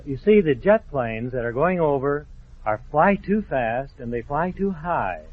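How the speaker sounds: ordinary delivery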